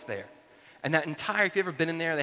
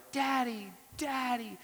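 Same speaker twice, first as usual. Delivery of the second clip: put-on high, drawn-out little-kid call; child yelling for dad; 11 semitones higher, slower than usual. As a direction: imitate a small daughter calling out in a high voice. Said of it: "sat in this car and yelled".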